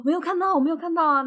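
high, fast flustered denial. Retold or imitated. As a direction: deny it in a hurry. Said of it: "他说".

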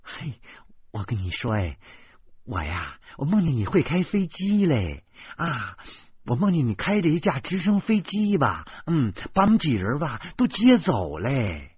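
lilting, eager wife telling a dream; suddenly excited. A narrator's reading. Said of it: "老婆忽然兴奋起来". (忽然兴奋地讲述梦境)

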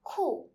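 "cool" said as Chinese "ku", dropping sharply; falling Mandarin tone. Shown as a demonstration. Teacher introducing a Chinese word, falling tone on "cool". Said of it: "third Chinese word with the first tone".

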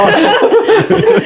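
sudden high-pitched laugh; high burst of laughter. Real, not acted. Laughing at friend forgetting handle.

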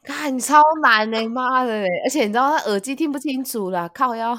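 loud, exasperated complaining; frustrated. Real, not acted. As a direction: vent about the hard word. Frustrated at hard word. (气急败坏)

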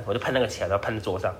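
ordinary talk, nothing marked

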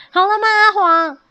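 high, loud ready check; calling out. A narrator's reading. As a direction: shout the question over to a friend. 朝木板上的阿黄喊话. (高声喊着询问)

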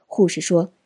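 usual speaking voice

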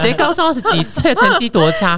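high laughing jab; laughing tease. Real, not acted. Host mocking the guest's math while laughing. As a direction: tease them through a laugh.

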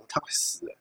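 a hushed retold whisper. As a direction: whisper it like a secret to a friend. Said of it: "然后就小声跟我讲".

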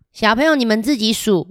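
ordinary delivery

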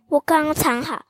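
ordinary delivery

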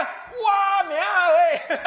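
high, slow drawn-out panicked cry; hammed-up yelp of terror; mock fright. Hunter cries out at the tiger behind him.

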